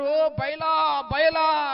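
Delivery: loud, high cry of O Baal; shouting. Retold or imitated. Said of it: "గట్టి గట్టిగా అరుస్తున్నారు".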